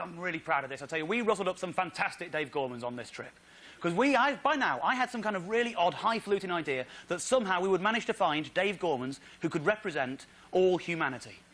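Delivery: ordinary talk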